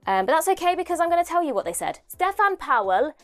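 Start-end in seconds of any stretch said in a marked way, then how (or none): 2.1-3.1 lightly put-on Welsh lilt; a jokey, half-hearted Welsh accent; bad Welsh accent. Vlogger mocking her own Welsh accent. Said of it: "awful accent".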